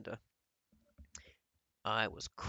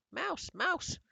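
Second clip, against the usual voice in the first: high, loud cry of alarm; alarmed shout; 7 dB louder than usual. A narrator's reading. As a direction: shriek as if spotting a mouse. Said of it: "no shouts of".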